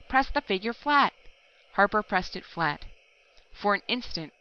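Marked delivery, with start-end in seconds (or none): 0.1-1.1 higher, louder, hurried urging; yammering urgently. A narrator's reading. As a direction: press him to act now, pitched up. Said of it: "yammered Pillbot".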